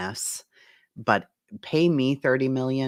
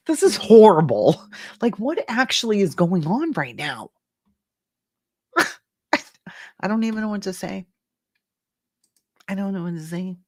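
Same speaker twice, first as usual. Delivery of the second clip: higher, shocked, half-laughing; stifled laughter; 8 semitones higher, 5 dB louder than usual. Real, not acted. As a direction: fight back a laugh in disbelief. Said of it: "I'm trying not to laugh".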